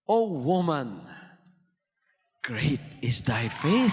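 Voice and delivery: low, slow majestic pronouncement; solemn Lord voice. Acted. Lord praising the woman, low and slow.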